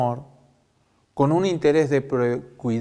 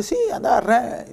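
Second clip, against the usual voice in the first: mock-eager sí, andá, pitched much higher; ironic; 9 semitones higher than usual. Prophet mocking the king's flatterers, ironic high-pitched cheer. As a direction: fake cheer, sarcastic. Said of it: "con voz irónica".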